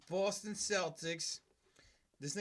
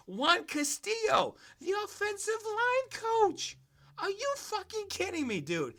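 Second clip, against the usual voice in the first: incredulous outrage; 14 semitones higher than usual. Real, not acted. Fuming to podcast listeners about the coach in high disbelief. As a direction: stretch it out in shocked anger.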